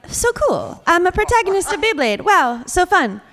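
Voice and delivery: thrilled to land the lead role; excited. Retold or imitated. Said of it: "I was like".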